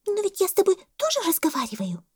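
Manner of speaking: very high little monkey voice; puzzled monkey. A narrator's reading. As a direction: pipe up as the monkey. Monkey breaks into the argument. (мартышка, очень высоким голоском)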